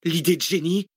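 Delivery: high-pitched, dry comeback; curt retort. A narrator's reading. Cécile correcting the one boasting of genius, curt retort. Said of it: "répliqua sèchement Cécile".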